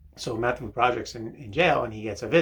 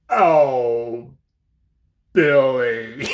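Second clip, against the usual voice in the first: loud, drawn-out mock moan; a silly moan in the shower; moaning Oh, Billy; 10 dB louder, much slower than usual. Talking to himself in the shower, a loud mock moan. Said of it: "I would be like".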